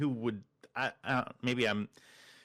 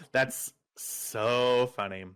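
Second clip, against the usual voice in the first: ordinary talk, nothing marked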